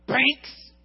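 normal talking voice

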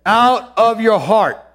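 slow, high, loud proclamation; booming slow call from the heart; Jesus crying out. Acted. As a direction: cry it out to the whole feast. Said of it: "He says".

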